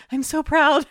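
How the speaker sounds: high-pitched gush of pride; mock-emotional proud squeal, pitched right up. Telling of a kid hooked on Fork videos, gushing proudly.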